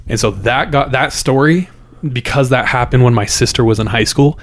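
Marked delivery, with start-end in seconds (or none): none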